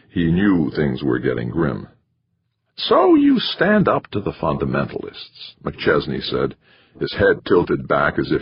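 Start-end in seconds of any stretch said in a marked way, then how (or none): 2.7-5.5 wiry manager voice. A narrator's reading. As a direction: lay it out to him like a lecture. Narrator voicing McChesney.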